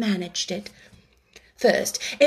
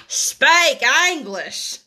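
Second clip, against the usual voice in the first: slow, louder, blunt jab; a cheeky retort; curt eaglet; 4 semitones higher, 4 dB louder, much slower than usual. A narrator's reading. The eaglet heckles the Dodo, slow and blunt.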